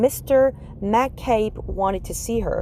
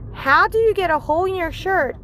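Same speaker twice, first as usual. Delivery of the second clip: put-on higher curious kid; child voice; 9 semitones higher than usual. Kenny asking the teacher about her shirt, higher.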